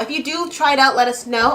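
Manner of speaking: normal talking voice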